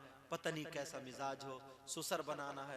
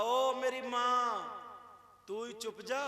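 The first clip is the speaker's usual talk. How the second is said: higher, louder, very slow drawn-out plea with hands folded; mock begging husband; 7 semitones higher, 8 dB louder, much slower than usual. Husband pleading with his wife, very slow and drawn-out. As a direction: stretch out the ओ like a henpecked husband begging. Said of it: "कहा".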